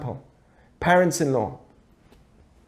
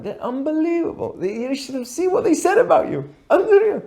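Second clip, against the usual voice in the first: gushing praise; 9 semitones higher than usual. Acted. Middleman rushing to tell the other side, gushing excitedly. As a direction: pitch climbing higher and higher in delight.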